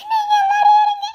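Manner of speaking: high, loud sobbing cry; tearful outburst. Acted. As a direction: burst out crying as she leaves. Leaving in tears.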